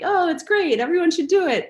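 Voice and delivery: high, loud, gushing salesy pitch; gushing charisma. Retold or imitated. Mocking her own pushy sales self, high gushing hype. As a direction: oversell it with bright hype. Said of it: "as opposed to my charisma".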